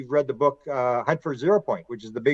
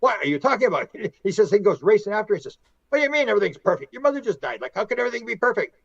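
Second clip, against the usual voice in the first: high, fast disbelieving questions; incredulous; 7 semitones higher, faster than usual. Retold or imitated. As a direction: chase her with the question, baffled. Husband chases wife, incredulous.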